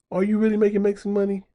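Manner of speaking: high-pitched, quick sceptical question; doubting challenge, pitched well up. Real, not acted. Challenging the creator's quoted claim.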